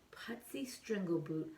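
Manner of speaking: hushed whisper of Putsy Strangleboot; whispered name. Acted.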